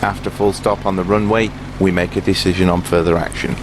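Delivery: normal talking voice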